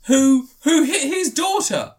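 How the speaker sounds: high, loud, laughing disbelief; shocked laughing telling. Real, not acted. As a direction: blurt the twist through laughter. Shocked build to twist.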